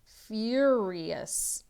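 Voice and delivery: plain reading voice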